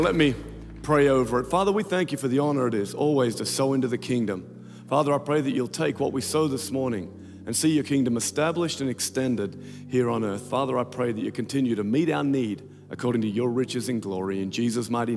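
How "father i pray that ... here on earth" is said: ordinary talk, nothing marked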